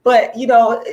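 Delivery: ordinary delivery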